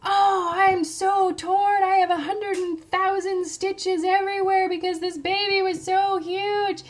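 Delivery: loud, high, drawn-out mock agony; hammed-up wail. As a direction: wail it with over-the-top self-pity. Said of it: "I just wanted to be like".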